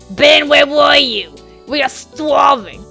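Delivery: loud, drawn-out, high whine; hammed-up bratty complaint, very loud; whining hungry kid. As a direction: wail it slowly like a starving child. Little sisters whining at Ben.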